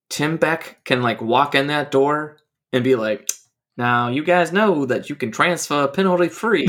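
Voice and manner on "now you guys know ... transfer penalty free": terrible hammed-up Southern colonel accent; put-on Southern accent. Coach walks in announcing free transfers, mock Southern accent. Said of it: "why he would have that accent".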